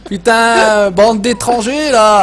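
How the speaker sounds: loud joking yell, pitch jumping up on the last one; mock angry shout. Streamer jokingly yelling at chat viewers to clear off, hammed-up shout. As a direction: pretend to chase them off, half laughing.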